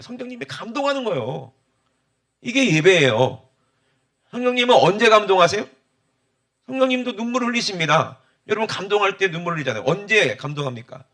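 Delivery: rising preaching fervor. Acted.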